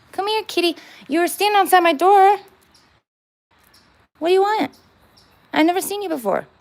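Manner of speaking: high coaxing voice for a cat; pet talk. Acted. Talking to a stray cat at her door, high coaxing pet voice.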